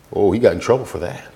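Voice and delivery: ordinary delivery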